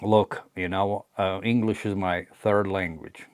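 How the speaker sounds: ordinary delivery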